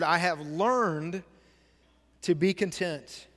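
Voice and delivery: "learned" said slowly and leaned on; stress on learned. Shown as a demonstration. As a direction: drag out "I have learned", hit learned. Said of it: "underlined that next word".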